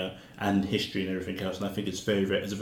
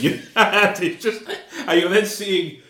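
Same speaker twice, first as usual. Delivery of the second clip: laughing; 10 semitones higher, 8 dB louder, slower than usual. Real, not acted. Laughing at the film's irony.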